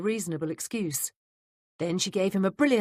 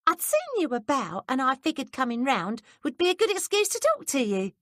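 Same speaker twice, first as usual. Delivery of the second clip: higher, coy maid's voice; 9 semitones higher, 4 dB louder than usual. A narrator's reading. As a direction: flutter it at him, pitched up. Betsy flirting.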